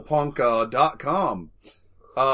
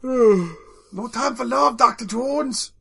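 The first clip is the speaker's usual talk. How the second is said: put-on high, slow kid voice; Short Round impression; 11 semitones higher, 4 dB louder, much slower than usual. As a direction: drag it out like a sidekick. Quoting Short Round line.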